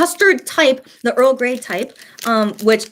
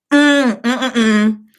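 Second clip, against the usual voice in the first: delighted food moan; 4 dB louder, much slower than usual. Real, not acted. First bite of custard donut.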